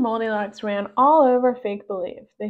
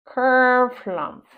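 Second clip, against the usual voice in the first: grumpy huff; 2 semitones higher, 5 dB louder than usual. Acted. Pug grumbling.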